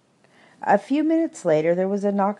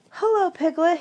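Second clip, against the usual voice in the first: much higher little squeak; squeaky Roo; 12 semitones higher, 4 dB louder than usual. A narrator's reading. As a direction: pipe it out like an excited little kangaroo. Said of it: "he squeaked".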